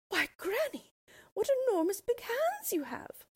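put-on high little girl's voice, growing uneasy; a small girl wide-eyed with worry. Girl questions granny.

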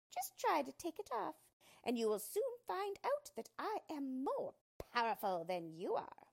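high-pitched, defiant villain voice; Yellow Dwarf. Acted. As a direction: challenge a rival as a furious little dwarf. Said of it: "he cried".

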